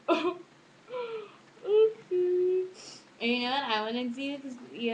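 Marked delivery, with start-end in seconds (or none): 0.1-2.6 awkward. Pretend. Everyone staring after laughing.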